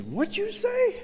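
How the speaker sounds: high incredulous what'd you say; Fred, startled. Retold or imitated. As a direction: voice him jumping up in disbelief. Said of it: "He said".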